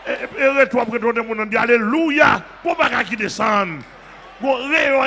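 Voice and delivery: loud fervent preaching; shouting sermon. Real, not acted. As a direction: rouse the congregation. Preaching at full force.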